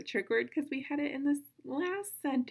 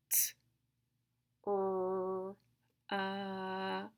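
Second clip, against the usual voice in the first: each sound separate, long pauses between; super slow sounds; much slower than usual. Shown as a demonstration. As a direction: stretch "R" and "A", keep "T" and "K" crisp. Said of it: "say the sound super slow".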